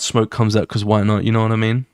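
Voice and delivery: ordinary delivery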